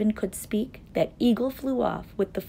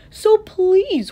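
high, loud entreaty; pleading elephant; 14 semitones higher, 9 dB louder than usual. A narrator's reading. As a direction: beg them earnestly.

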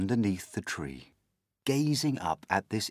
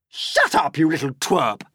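loud, high, scolding aunt; angry snap; 7 semitones higher, 7 dB louder than usual. A narrator's reading. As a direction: snap at the boy to silence him. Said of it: "Aunt Spiker snapped".

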